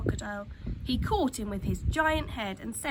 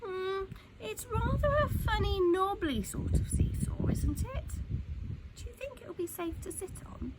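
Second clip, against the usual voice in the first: lightly voiced, higher wary girl; an older girl questioning the seesaw, lightly voiced; doubtful older girl; 9 semitones higher than usual. A narrator's reading. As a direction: sound a bit unsure about the strange seesaw. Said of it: "a girl who was older than the others said".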